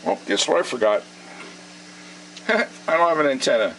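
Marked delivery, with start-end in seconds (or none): none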